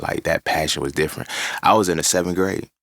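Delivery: ordinary talk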